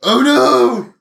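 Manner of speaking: high, loud, startled critter voice; panicked raccoon cry. Retold or imitated. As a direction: yelp it like a spooked little animal. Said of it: "they're like".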